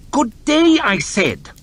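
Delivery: high, loud final dismissal; curt dismissal. Acted. Lord chamberlain cutting off the stammering farmer, curt. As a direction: shut him down hard. Hoff cuts him off sharply.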